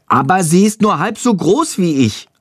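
put-on high-pitched child's protest; high, defensive kid voice; defensive little boy. A narrator's reading. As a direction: argue back as a small boy. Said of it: "verteidigt sich Tom".

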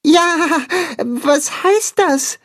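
very high, slow little voice; small animal asking innocently; very high slow child-like question; high puppy voice. Acted. Tacho's voice.

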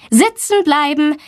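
lightly voiced teacher ordering pupils to stay put; a touch of classroom authority; firm teacher's order. A narrator's reading. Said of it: "bestimmt Frau Blumberg".

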